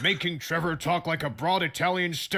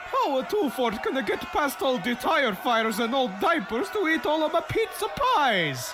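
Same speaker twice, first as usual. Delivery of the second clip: broad, hammed-up Italian accent, pitched higher; 6 semitones higher than usual. Giuseppe lamenting his pizzeria to the crowd in a hammy Italian accent. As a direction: play up a cartoon Italian accent. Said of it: "talk like an Italian stereotype".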